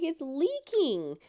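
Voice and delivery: high, slow incredulous complaint; mother protesting to the doctor, high and slow. Retold or imitated. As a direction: stretch it out in disbelief.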